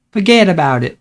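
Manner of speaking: a bad New Yorker's "forget about it"; horrible New York accent. Streamer tossing off a bad New York accent. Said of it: "That's my horrible New Yorker".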